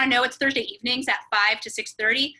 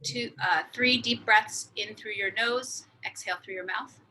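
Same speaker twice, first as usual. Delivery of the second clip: guided meditation; 6 dB quieter than usual. Acted. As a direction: calmly guide the breaths in and out. Leads a breathing exercise.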